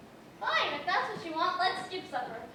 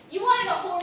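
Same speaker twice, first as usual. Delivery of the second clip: angry; 7 dB louder than usual. Acted. Bitter marital quarrel.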